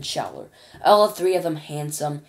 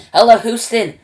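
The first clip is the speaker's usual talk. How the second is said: loud, higher radio hail; calling out; 7 semitones higher, 14 dB louder than usual. Acted. Shuckworth calling ground control over the radio, loud. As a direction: hail Houston at full volume.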